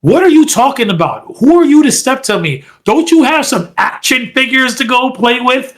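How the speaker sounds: mock yell. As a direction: snap back like an angry wrestler. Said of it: "Randy yells at him".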